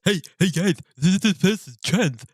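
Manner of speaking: high, loud, whiny mocking voice; mocking bigot voice. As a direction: sneer it like a pointing, gossiping bigot.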